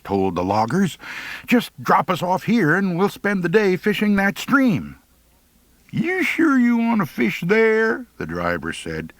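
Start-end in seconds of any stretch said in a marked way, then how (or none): 1.5-5.0 put-on higher eager boy voice; eager kid asking a favour; young Wretch. Wretch asks the loggers to drop them off, eager. 5.9-8.0 higher teasing logger voice; sly logger. Acted. The driver slyly warns the boys about fishing, teasing.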